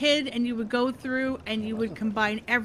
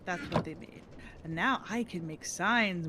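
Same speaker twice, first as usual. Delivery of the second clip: ordinary delivery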